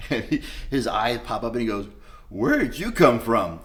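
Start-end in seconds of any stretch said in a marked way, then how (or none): 2.3-3.6 surprised. Retold or imitated. Therapist's surprised reaction.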